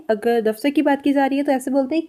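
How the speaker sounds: normal talking voice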